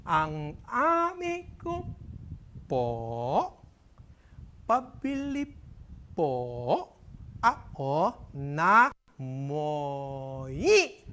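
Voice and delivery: drawn-out, syllable by syllable; painfully slow impaired speech. Acted. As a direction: crawl through the words. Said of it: "Dadaan-daanin ko".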